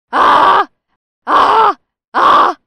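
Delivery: short, high, very loud screams; reenacted screams. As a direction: scream out like a madwoman, one burst after another.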